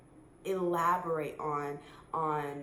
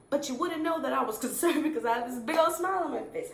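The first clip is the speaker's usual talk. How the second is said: laughing through the words, fast and loud; 6 semitones higher, 5 dB louder, faster than usual. Real, not acted. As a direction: burst out laughing mid-sentence. Laughing at her own demo.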